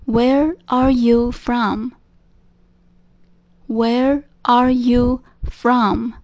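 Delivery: slow teacher model. Shown as a demonstration. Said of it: "slow model sentence".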